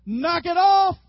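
very loud, high-pitched bellow; dad's yell. Acted. Father at the bedroom door to his sons, full yell. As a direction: yell it upstairs at the wrestling boys. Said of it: "would just open that door and yell".

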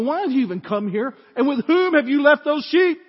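put-on loud and high, scolding his kid brother; older brother Eliab scolds David for leaving his sheep, angry. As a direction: bark it at David like a furious big brother. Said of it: "And he said".

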